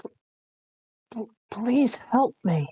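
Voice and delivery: high, stammering plea for help; weak plea. Acted. Kieran stammers a plea to Salvador and Dante, high and stammering. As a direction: beg through the stammer.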